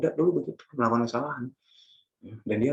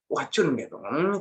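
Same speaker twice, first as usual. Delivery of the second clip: ordinary talk, nothing marked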